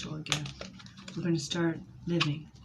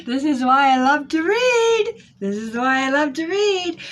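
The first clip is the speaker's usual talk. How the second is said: high, loud excited squeal; thrilled outburst; 10 semitones higher, 10 dB louder than usual. Real, not acted. Tarot reader thrilled by the cards, squealing loudly. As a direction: shout it out, delighted.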